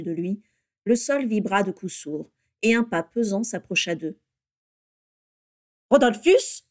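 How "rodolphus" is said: high, loud, incredulous call; furious surprise at seeing him. A narrator's reading. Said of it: "dit la voix furieuse de Bellatrix". (appel furieux et incrédule)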